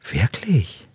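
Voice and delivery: eager whispered question. A narrator's reading. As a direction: breathe it out in a whisper. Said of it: "flüstert er".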